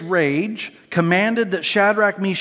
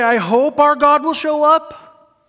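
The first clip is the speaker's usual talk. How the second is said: higher, louder and slow, full of doubt; mock doubter's hopeful plea; uncertain hope; 8 semitones higher, 5 dB louder, slower than usual. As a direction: plead it as if unsure God will come. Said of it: "They don't say".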